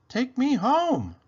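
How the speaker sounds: high, slow, otherworldly call; marble's voice. Acted. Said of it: "The marble seemed to say".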